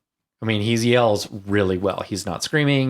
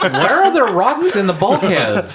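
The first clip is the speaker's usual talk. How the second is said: high, loud, drawn-out disbelief; hammed-up incredulous outcry; mock incredulity; 11 semitones higher, 6 dB louder, slower than usual. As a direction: protest as a baffled viewer, amused. Voices baffled viewer's protest.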